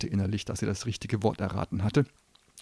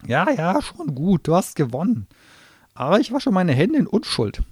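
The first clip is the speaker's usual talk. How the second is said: high, loud sulky gnome voice; grudging gnome; 11 semitones higher, 9 dB louder than usual. Acted. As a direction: give in with bad grace. Gnome swearing oath.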